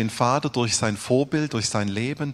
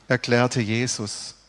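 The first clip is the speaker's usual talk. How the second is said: usual speaking voice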